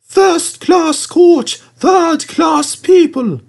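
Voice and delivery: very high, slow squeaky coach voice; shrill-free high nagging coach; grumbling coach. A narrator's reading. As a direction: play a snooty little coach complaining. Coach Agnes grumbling at passengers.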